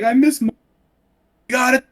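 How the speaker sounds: put-on high, strained boy voice; high whiny boy voice. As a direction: squeak it out like a scared boy. Donkey boy answer quoted.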